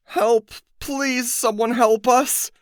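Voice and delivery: high, desperate pleading cries; frantic shrieking plea; scream for help. A narrator's reading. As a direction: cry out from a cage.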